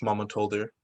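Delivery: usual speaking voice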